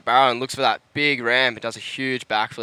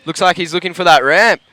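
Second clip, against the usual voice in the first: loud, rising live call; breathless sideline reporter; 4 semitones higher, 8 dB louder than usual. Real, not acted. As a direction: build up as the wave comes. Sideline reporter calls a wave live.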